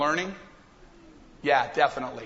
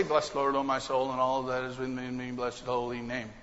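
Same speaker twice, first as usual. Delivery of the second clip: soft, low mumbled recital; rote, going through the motions; 7 semitones lower, 7 dB quieter than usual. Shown as a demonstration. The preacher mocking a heartless rote psalm, soft and low. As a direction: rattle it off without heart. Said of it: "I don't want to just say".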